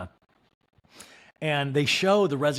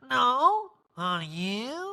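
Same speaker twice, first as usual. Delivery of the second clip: wonky high put-on voice; [name]; 10 semitones higher, much slower than usual. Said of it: "in a kind of a wonky voice".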